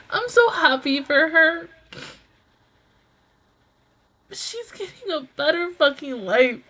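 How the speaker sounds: real tearful outburst, pitched high; overwhelmed happy sobbing, breaking into loud wails; crying with happiness. Real, not acted. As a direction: cry through the words, overjoyed for her. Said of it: "I'm about to cry again".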